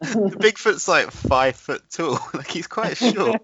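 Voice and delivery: high voice breaking into laughter; laughing. Real, not acted. Mocking the tiny Bigfoot to a co-host, laughing high. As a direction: giggle through the words.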